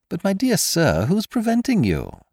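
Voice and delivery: high-pitched, airy feigned surprise; mock innocence. A narrator's reading. Stranger toying with Kesselbach, mock innocence. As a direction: protest politely, toying with him.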